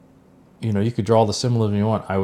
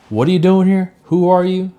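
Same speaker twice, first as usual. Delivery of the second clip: raised, higher interrogating voice; louder, pitched-up questioning; third degree; 8 semitones higher, 4 dB louder than usual. Retold or imitated. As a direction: grill him like a suspicious doorman. Guy interrogating at the door.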